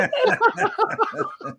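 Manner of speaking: high-pitched, helpless laugh; long squealing laugh; laughter. Real, not acted. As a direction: crack up at the teasing. Laughs at the teasing.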